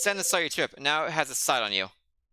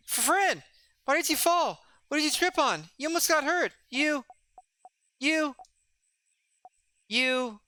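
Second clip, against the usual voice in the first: put-on higher, hurt and bewildered kid voice; a kid demanding why his friend fell on purpose; upset young friend; 11 semitones higher than usual. Young friend confronts Fryn after the battle, higher hurt voice.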